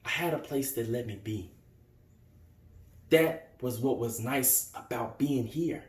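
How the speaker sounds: low, soft, halting confession; quiet hurt. Acted. Young man remembering his refuge at Drew's, quiet hurt.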